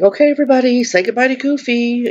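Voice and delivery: high, sing-out crowd announcement; character greeter. Retold or imitated. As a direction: imitate daughter cueing a crowd, pitched high. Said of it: "she'd be like".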